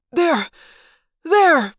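high, lightly rasped exclamation; a hint of weary rasp; weak rasp. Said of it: "the human controller rasped weakly".

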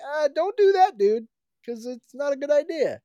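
very high, laid-back hero voice; casual hero voice. Acted. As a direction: warn him lazily, way up high. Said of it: "and he's like".

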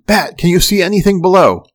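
urgent high yell to the crewman below. A narrator's reading. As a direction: shout down the interphone for an answer. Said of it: "I yelled".

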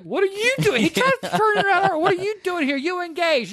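squeaky high caught-out voice; high startled squeak, hammed up; mock high surprised voice. Father caught at the opera, high squeaky surprise. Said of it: "he's like".

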